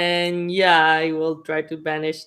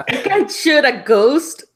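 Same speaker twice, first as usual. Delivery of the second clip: laughing exasperated shout; 6 semitones higher, 5 dB louder than usual. Acted.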